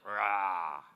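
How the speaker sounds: a tiny low "ra", just the start of a roar; baby's lion sound. Retold or imitated. Preacher imitates his baby granddaughter's lion roar, low and clipped. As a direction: mimic a toddler trying to roar. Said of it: "nomás la primera parte de la palabra dice".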